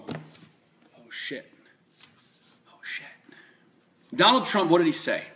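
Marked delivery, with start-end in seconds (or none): 0.1-3.1 hushed whisper, barely voiced; whispered panic. Rival candidates caught off guard, whispering in panic. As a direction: breathe it out like a scared candidate. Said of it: "every single one of those assholes on the stage was going".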